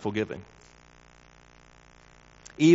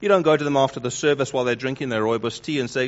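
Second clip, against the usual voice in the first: ordinary delivery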